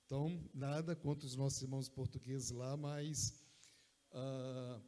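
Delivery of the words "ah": ordinary talk, nothing marked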